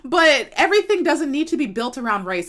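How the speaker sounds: normal talking voice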